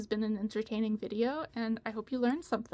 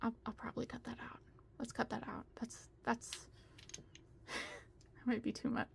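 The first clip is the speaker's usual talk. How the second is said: whispered aside to herself; whispering; 13 dB quieter than usual. Real, not acted. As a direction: whisper it like a private note. Aside about cutting it out.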